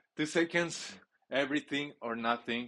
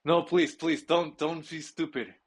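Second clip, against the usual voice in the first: pleading; 5 semitones higher, 6 dB louder, slower than usual. Real, not acted. Begging the team in the game.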